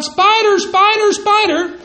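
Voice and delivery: high shrill-pitched mock yell, repeated; yelling spider. Wife yelling at husband on the couch, high mock yell. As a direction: yell it out high, three times over. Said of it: "she really yells out".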